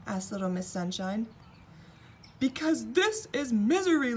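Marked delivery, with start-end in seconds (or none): 2.4-4.1 higher, louder weeping voice; the king weeps about Misery Land. A narrator's reading. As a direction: weep it out. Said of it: "wept the king".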